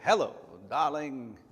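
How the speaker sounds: put-on slow, suave old-movie greeting; Cary Grant. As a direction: purr it like a classic film star. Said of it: "like I'm Cary Grant".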